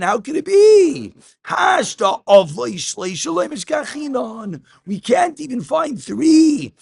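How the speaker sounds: high, loud protest; incredulous objection. Acted. Teacher voicing Rava's objection to Rav Tarfon, high and loud. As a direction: cry out how could it be.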